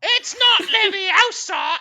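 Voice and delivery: high, loud, slow scolding correction; hammed-up know-it-all girl; Hermione impression. Mocking Hermione correcting Ron, high loud drawn-out scold. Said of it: "my world-renowned ... impression of".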